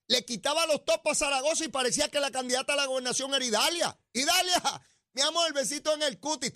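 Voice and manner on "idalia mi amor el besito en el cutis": high-pitched, effusive call to a darling; gushing greeting. Acted. Radio host fawning over an admired politician, gushing. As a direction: gush over her in a raised pitch. Acted gushing appeal to Idalia.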